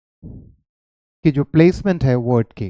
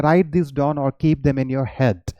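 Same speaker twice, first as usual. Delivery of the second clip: slow dictation; much slower than usual. Shown as a demonstration. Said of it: "तो मैंने क्या किया".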